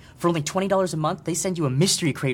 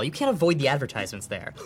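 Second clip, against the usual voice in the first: usual speaking voice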